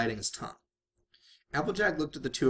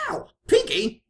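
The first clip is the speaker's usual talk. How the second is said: sharp high yelp of pain, much louder; 9 dB louder, slower than usual. A narrator's reading. Rainbow yelps at Pinkie after being slapped, loud pained yelp. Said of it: "yelped as she got slapped".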